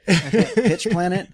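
'Pitch Planet' said slowly, a hard voiceless P starting 'Pitch'; misheard as Pitch. Shown as a demonstration. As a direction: spell out 'Pitch' with a crisp P, slow and amused. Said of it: "it sounded like pitch".

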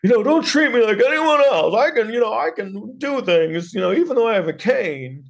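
high-pitched, hammed-up movie monologue; mock big speech. Student with a cane making her big speech, mock-earnest. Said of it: "she makes this big speech".

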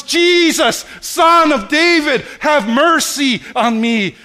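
very high, loud desperate cry; shouting for mercy. Acted. Blind beggar crying out to Jesus, full-voiced shout. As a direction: cry out to be heard over everyone. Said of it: "starts shouting at the top of his lungs".

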